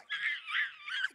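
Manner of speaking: put-on high shrill scream from the startled girls; girls' scream. Girls screaming as the pastor's son jumps out at them. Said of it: "the girls like".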